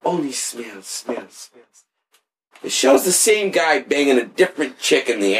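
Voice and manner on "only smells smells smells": fading echo, softer with each repeat. Retold or imitated. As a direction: let it trail away like an echo in the background. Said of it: "that guy's voice in the background echoing".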